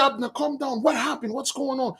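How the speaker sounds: quiet reassurance to a furious friend; soothing. Retold or imitated. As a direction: talk him down gently. Rizpah soothing angry Abner.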